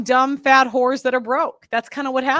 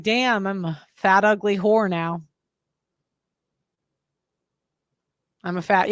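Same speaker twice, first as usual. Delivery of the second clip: bleak self-talk; 5 semitones lower than usual. Retold or imitated. Bleak self-judgement looking back.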